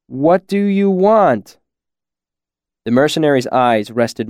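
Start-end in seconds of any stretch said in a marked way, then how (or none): 0.1-1.6 drawn-out, higher-pitched question; slow, raised and deliberate. A narrator's reading. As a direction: ask very slowly so a befuddled listener follows. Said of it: "Kvothe asked slowly".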